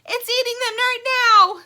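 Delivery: very high, loud panicked shriek; Stingray's panicked high cry. Acted. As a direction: scream the alarm. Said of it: "Stingray cries".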